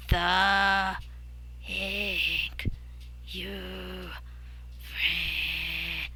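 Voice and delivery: fading halting rasp, cut off; rasping broken voice. Acted. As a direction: rasp each word then cut. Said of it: "It rasped every sound, cut out suddenly".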